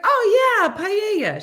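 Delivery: very high, loud imitation of delight; excited recognition. The neighbouring lady knows paella, excited recognition. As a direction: gush with mock sudden recognition.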